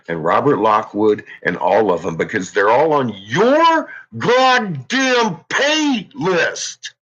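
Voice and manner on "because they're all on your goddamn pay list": drawn-out sneer. Real, not acted. Drawn-out mocking emphasis.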